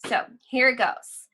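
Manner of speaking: normal talking voice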